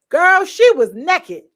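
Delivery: loud, slow nekkid with a laugh in it; playful nekkid. Shown as a demonstration. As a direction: ham up girl, she was nekkid. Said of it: "but we say naked".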